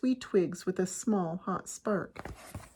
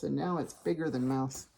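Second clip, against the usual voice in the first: ordinary delivery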